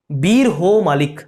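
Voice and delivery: slow, deliberate बीर हो मालिक; drawn out for students to remember; slow mnemonic. Shown as a demonstration. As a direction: spell out the memory trick slowly. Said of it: "तो बोलेंगे जो बीर है वो मालिक है".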